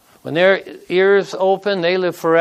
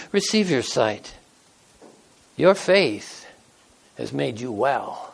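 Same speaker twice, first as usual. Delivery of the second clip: gentle Jesus; 6 semitones lower, 6 dB quieter than usual. A narrator's reading. Jesus speaks softly to healed man.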